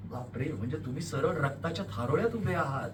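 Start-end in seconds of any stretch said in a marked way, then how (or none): none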